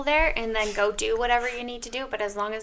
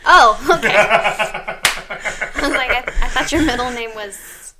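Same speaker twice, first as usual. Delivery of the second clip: laughing out loud at the mix-up; 11 dB louder, slower than usual. Real, not acted. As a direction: laugh through the words.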